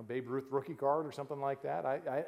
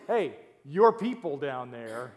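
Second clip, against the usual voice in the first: slow, pointed "your people", YOUR leaned on; drawn-out disowning "your"; stress on your; 3 semitones lower, 5 dB louder, slower than usual. Retold or imitated. God disowning the people to Moses, slow stress on your. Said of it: "this is God saying to Moses".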